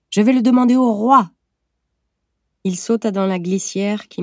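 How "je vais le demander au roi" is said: shouted high and loud; calling out. A narrator's reading. Boy running off laughing, shouting back to his mother. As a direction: call it out while running off. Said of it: "Il cria".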